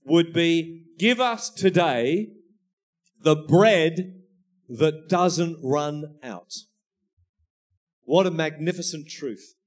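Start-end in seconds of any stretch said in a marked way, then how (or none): 1.0-6.5 slow, weighty prayer line with long pauses; slow emphatic prayer. Shown as a demonstration. Preacher slowly offering his better translation to the congregation. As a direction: savour each phrase. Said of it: "a better way of translating".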